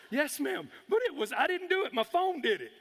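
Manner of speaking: high, hurried protest; acted-out panicky excuse to an officer; flustered denial.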